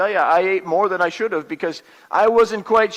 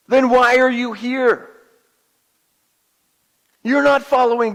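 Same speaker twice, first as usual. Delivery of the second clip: forceful, raised-pitch scolding; angry rebuke; 3 semitones higher, 3 dB louder than usual. Acted. Moses rebuking people out looking for manna, angry. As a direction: hammer each question at them in anger. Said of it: "but he was angry".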